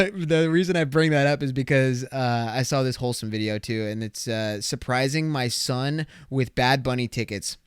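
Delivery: normal talking voice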